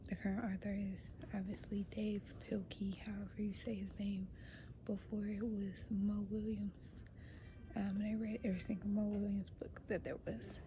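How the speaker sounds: hushed library whisper. Real, not acted. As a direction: whisper so nobody hears.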